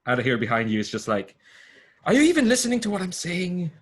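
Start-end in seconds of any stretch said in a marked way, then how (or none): 2.0-3.7 high, loud, fast outburst; exasperated shout. Acted. Character calling to the player lost in a vision, exasperated shout. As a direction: snap loudly at someone not listening.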